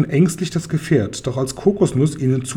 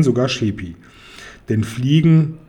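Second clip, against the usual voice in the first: ordinary delivery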